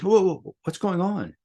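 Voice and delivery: alarmed call. Retold or imitated. Man stopping a frantic mother with a bleeding child, alarmed call. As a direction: call out to halt the running mother.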